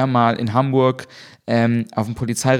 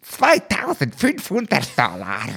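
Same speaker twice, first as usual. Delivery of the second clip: Reich-Ranitzky impression. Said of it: "klingt dabei so ein bisschen wie Marcel Reich-Ranitzky".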